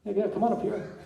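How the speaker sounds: usual speaking voice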